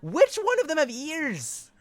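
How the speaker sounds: high, slow, loud demand; incredulous challenge. Real, not acted. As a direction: drag out the question in disbelief. Defending herself to chat.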